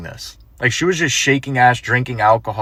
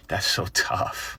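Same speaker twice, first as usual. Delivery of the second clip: unvoiced pity; 4 semitones lower, 8 dB quieter, much slower than usual. Real, not acted. Commentator's pitying sign-off.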